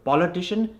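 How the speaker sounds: quick politician with a forward Indian "o"; Indian accent. Shown as a demonstration. Coach recaps the form to replace, Indian accent. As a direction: say "politician" the Indian way, rounded first vowel. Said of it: "convert that O into an R".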